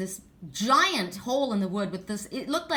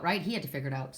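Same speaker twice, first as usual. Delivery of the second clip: ordinary talk, nothing marked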